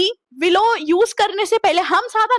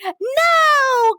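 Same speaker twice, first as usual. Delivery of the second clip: high, louder shouted no; mock yell; 8 semitones higher, 4 dB louder than usual. Girl yelling no in her sleep, high shout. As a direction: shout it out like a nightmare. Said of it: "चिल्लाने लगती है".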